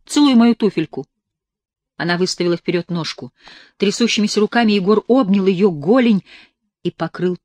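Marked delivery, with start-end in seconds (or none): 0.1-1.1 higher, louder imperious order. A narrator's reading. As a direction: command him to grovel. Girl's command. (властный приказ)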